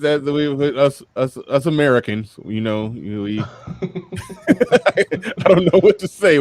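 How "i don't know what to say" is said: high, laughing through the words. Real, not acted. Host cracking up over Americans and accents, laughing. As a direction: laugh it out, pitched up.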